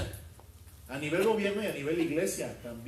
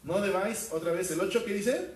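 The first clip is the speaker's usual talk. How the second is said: scripture proclamation; 3 semitones higher, 4 dB louder than usual. Acted. Quoting scripture emphatically.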